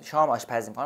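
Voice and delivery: ordinary talk, nothing marked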